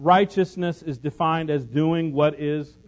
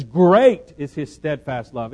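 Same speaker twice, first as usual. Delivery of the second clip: emphatic quote; 2 semitones lower, 7 dB louder than usual. Shown as a demonstration. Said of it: "Verse eleven says".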